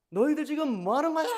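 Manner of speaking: acted angry parent scolding the children, high and loud. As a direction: raise pitch and volume, imitating a parent's outburst. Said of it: "크게 화를 내잖아요".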